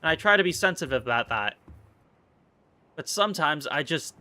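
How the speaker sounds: ordinary talk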